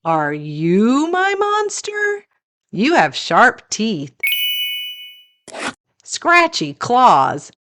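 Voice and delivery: ordinary delivery, nothing marked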